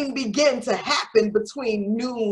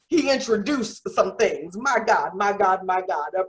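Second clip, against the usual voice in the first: normal talking voice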